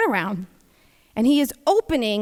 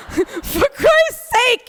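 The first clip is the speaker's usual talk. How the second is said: exasperated; 11 semitones higher, 4 dB louder, much slower than usual. Retold or imitated. Exasperated at son.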